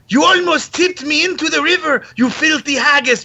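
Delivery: mock Swedish accent. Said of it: "he has a Swedish accent".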